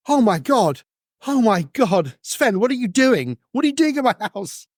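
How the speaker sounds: high, panicked disbelief; shocked. Acted. Magnus confronting Sven in his house, high and shocked. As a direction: voice jumps up in alarm at the intruder. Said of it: "he kind of looks at you and goes".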